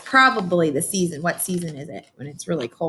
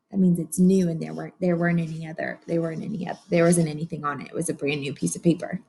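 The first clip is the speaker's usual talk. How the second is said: usual speaking voice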